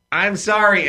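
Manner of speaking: a full, unhedged I'm sorry; firm plain apology. Shown as a demonstration. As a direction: say I'm sorry slowly, firmly, and stop. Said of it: "and don't go on to".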